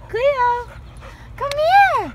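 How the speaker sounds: high, loud call; calling the dog. Real, not acted. Calling the dog to come here, high and loud. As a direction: call a dog back from a distance.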